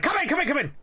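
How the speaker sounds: high, urgent calling out; imitated impatient call. Boss answers a knock mid-rant, impatient shout. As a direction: imitate a boss yelling toward the door. Ranting boss calls to door.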